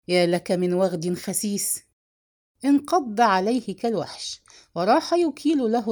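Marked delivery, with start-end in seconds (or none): none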